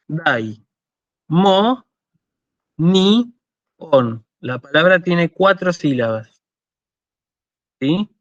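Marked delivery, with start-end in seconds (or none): 0.1-4.2 "Dai, mo, ni, on" in separate syllables with long pauses. Shown as a demonstration. Teacher splitting a Greek word for students, syllable by syllable. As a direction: break "Dai, mo, ni, on" into four isolated beats. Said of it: "podemos separarlo en sílabas".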